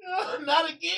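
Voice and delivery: high laughing cry; laughing mock wail. Real, not acted. Cohost fakes despair at another call, laughing. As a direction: pretend despair through laughter. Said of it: "cohost mock dread".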